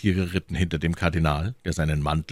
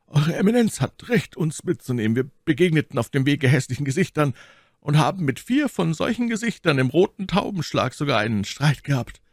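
lightly voiced musketeer, somewhat higher; respectful Athos; 10 semitones higher than usual. A narrator's reading. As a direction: report respectfully to a superior. Voices Athos reporting to cardinal.

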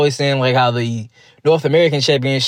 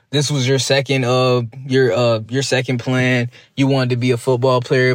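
normal talking voice

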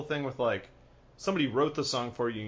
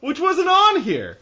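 high, loud outburst; loud surprised; 14 semitones higher, 11 dB louder than usual. Real, not acted. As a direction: blurt it out in disbelief.

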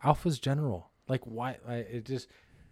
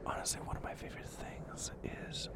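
very soft, intimate whisper; hushed ASMR performance; best ASMR artist; 19 dB quieter than usual. Shown as a demonstration. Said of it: "be my best A S M R artist".

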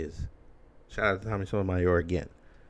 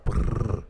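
a loud buzzing trilled R, warming up; rolled R trill; 7 dB louder than usual. Shown as a demonstration. Warming up before saying the surname, a loud trilled R. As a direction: roll the R hard like a motor, Brrr. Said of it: "gonna try to roll my R".

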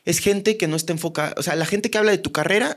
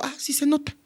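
neighbour's reply; 10 semitones higher, 3 dB quieter than usual. Retold or imitated.

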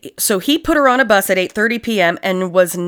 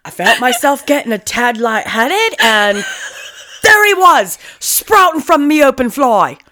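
hammed-up British accent; 3 semitones higher than usual. Host mocking the killer's story in a hammed-up British accent. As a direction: ham up a rough British bloke.

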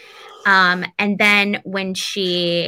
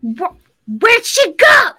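witch shriek; 10 semitones higher, 8 dB louder than usual. Retold or imitated. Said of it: "was like".

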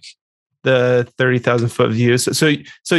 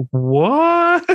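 surprised what. Retold or imitated. Reacting to a surprise character switch.

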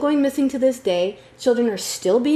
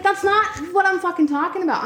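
usual speaking voice

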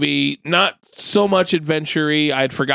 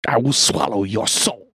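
Shang Tsung villain voice; 4 semitones lower, slower than usual. Retold or imitated.

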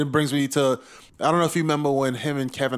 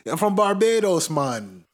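quick high mock Jamaican accent, laughing; tossing off a playful fake Jamaican accent; 6 semitones higher than usual. Telling people in Barbados, in a teasing mock Jamaican accent. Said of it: "putting on a Jamaican accent".

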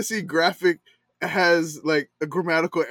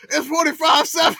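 high, loud mock yell at the committee; imitating a furious fan; mad fan yelling; 10 semitones higher, 6 dB louder than usual. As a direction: yell the score like an outraged fan. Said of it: "He was so mad".

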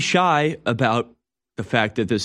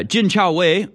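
name said slowly and higher in a mock Chinese accent; 7 semitones higher, slower than usual. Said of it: "that Chinese accent right there".

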